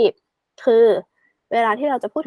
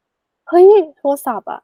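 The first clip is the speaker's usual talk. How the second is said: higher, louder mock exclamation; acted excitement; 6 semitones higher, 6 dB louder, much slower than usual. Teacher acting out telling a friend about the phone, excited. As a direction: call it out like big news to a pal.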